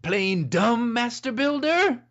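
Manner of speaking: put-on character voice. Shown as a demonstration. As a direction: pitch it up into a questioning cartoon voice. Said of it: "what did you expect all of a sudden to come out of my voice?".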